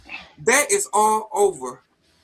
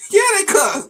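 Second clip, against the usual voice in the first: amused retort; 12 semitones higher, 8 dB louder, slower than usual. Retold or imitated. Mocking wife's naivety, laughing.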